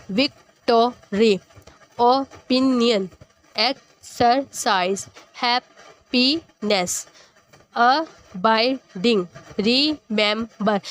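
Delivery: विक्टोरी, ओपिनियन, एक्सरसाइज, हैप्पीनेस, अबाइडिंग, रिमेंबर slowly drawn out, each syllable apart. Shown as a demonstration. Teacher sounding out words for students, slow and split into syllables. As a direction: stretch each word so the class hears its parts. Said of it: "बोलते हुए हमारे parts divide हो जाते हैं".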